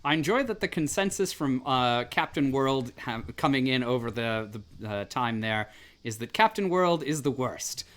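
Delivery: ordinary delivery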